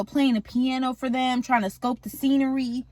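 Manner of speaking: normal talking voice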